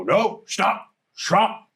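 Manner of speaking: orc pleading to stop. Acted. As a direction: call out to halt the fight. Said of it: "you hear the other go".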